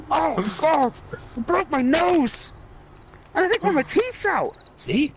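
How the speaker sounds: hammed-up whine about a broken nose; Scottish moan. Character complains after punch.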